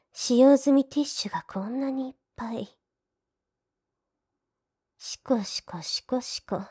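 hushed teasing whisper; mocking whisper. Maid mocks his used tissues.

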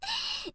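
a short high giggle that falls away quietly; soft teasing giggle. Acted. Big sister laughing at her little brother's bet, soft high giggle. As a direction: giggle at him, amused and superior.

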